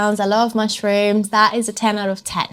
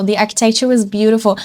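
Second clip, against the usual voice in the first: ordinary talk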